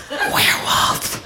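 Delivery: slow, drawn-out spooky whisper; whispered taunt. Retold or imitated. Sister taunting after being told shut up, slow whisper. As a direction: needle her in a stretched whisper.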